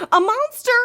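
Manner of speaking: high, loud scared cry; frightened shout. Acted. Baby shouting in fright at the window. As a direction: scream it out in fright. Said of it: "shouted Baby".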